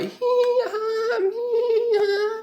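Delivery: very high giggle imitation; kids giggling. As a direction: squeal like laughing kids.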